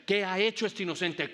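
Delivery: pregunta alta y fuerte a la turba, imitando a Pilato; Pilato protestando. Acted. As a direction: encarna levemente a un Pilato que protesta. (Pilato alzando la voz)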